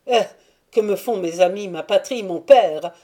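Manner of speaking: éclat bien plus fort, défiant; Andry rejette père et patrie, éclat fier et fort; fière révolte. Acted. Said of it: "Reprit Andry en relevant fièrement le front". (éclat de voix fier et défiant)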